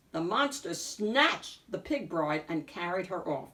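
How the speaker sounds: plain reading voice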